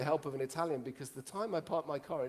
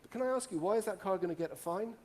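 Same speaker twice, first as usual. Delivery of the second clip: normal talking voice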